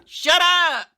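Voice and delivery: sudden high, loud yell; yelling at dog. Real, not acted. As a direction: snap it out to silence the dog.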